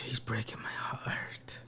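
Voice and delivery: slow, hushed mock-tearful whisper; barely voiced, hammed-up heartbreak; mock heartbroken. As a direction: sigh it out softly, playing close to tears. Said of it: "Before I start crying".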